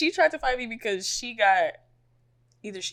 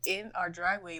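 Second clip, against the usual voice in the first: ordinary delivery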